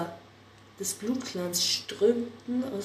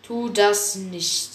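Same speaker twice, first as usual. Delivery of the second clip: slow warning; 5 dB louder, much slower than usual. Acted. Warning before the fight.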